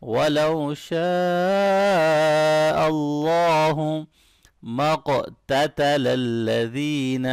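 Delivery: شَاءَ held very long and sung, heavy اللَّهُ; slow chanted recitation; long madd. Shown as a demonstration. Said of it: "شاء یہاں پر بڑی مد ہے".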